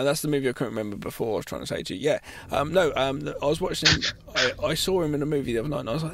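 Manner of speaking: normal talking voice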